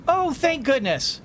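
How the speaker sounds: ordinary talk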